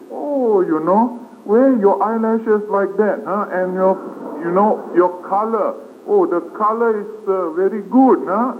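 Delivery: high, gushing mock compliments; high-pitched mock flattery about eyelashes and color; imitating gushing partygoer. Monk imitates gossiping partygoers.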